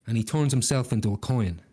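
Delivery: usual speaking voice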